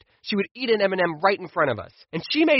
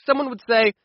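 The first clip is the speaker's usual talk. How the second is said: ordinary talk, nothing marked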